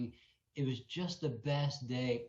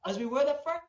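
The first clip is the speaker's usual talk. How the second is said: laughing; 11 semitones higher, 6 dB louder than usual. Retold or imitated. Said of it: "crying and laughing and going".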